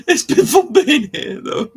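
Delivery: loud, high burst through laughter; laughing. Real, not acted. Host signing off to viewers, laughing loudly. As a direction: crack up while talking.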